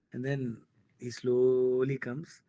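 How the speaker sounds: slowly stretched storyteller's beat; slow dramatic build. Retold or imitated. Telling friends how the critic approached, slow and dramatic. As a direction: drag each word out slowly, building suspense. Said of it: "very dramatic, very slow".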